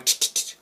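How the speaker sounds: quick voiceless "tch" stabs; imitated stabbing noise. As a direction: mimic repeated knife jabs with the tongue. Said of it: "stabbing them in the neck".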